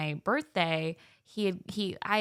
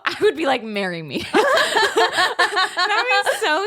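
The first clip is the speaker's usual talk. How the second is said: laughing joke; 12 semitones higher, 8 dB louder, slower than usual. Pretend. Joking about dream housewarming gift.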